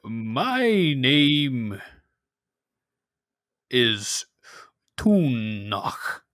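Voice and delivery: slow, odd villager greeting; cave dweller voice. Acted. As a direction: greet the stranger warily. GM voices Tunach.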